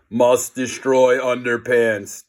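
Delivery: slow, droning zombie chant; zombie groan. A narrator's reading. Zombie nerds close in, slow groaning chant. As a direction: drone it like a mindless zombie. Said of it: "they groaned".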